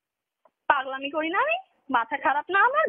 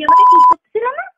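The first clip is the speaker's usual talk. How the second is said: a loud, much higher scream of accusation; furious scream; 14 dB louder than usual. Real, not acted. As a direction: scream it at him. Girlfriend screaming during phone quarrel. (প্রেমিকের উপর তীব্র চিৎকার)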